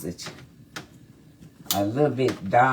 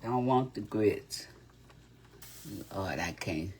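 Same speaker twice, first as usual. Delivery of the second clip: ordinary talk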